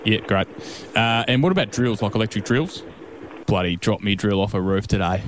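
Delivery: low, broad Aussie tradie; easygoing Aussie ocker bloke. Pretend. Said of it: "put on a bit of an ocker voice".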